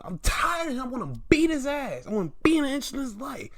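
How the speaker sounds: high-pitched fed-up complaint; mock angry rant. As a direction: imitate someone at the end of his patience. Acts angry Lashley quote.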